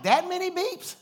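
can't believe what you're hearing; shocked comic question; incredulous. Retold or imitated. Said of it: "I'm like".

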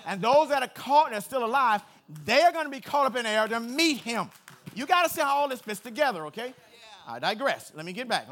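loud fired-up preaching, pushing every line; preaching at full force. Acted. Pastor fires up the church, preaching loud. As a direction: preach it hard to the congregation.

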